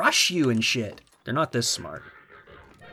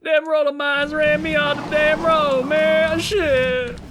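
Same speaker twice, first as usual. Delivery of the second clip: high, wailing complaint; worked-up yelling; 13 semitones higher, 4 dB louder, slower than usual. Real, not acted. Player run off the road by roller mines, high wailing yell. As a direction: cry out way up high.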